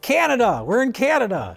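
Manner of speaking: high, exclaimed spotting of Canada; excited recognition. Retold or imitated. Movie viewer recognizing a Canadian actress, excited recognition. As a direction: call it out high, like a sudden discovery. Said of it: "I was like".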